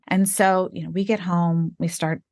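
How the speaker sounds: usual speaking voice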